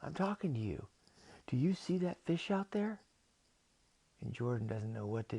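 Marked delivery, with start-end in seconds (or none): 0.0-3.0 higher-pitched, insistent octopus; high questioning creature voice; talking octopus. Acted. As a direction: press the question in a raised voice. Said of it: "and the octopus says".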